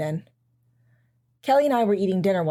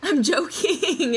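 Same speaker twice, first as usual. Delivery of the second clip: teasing; 6 semitones higher, much slower than usual. Acted. Mom teasing daughter.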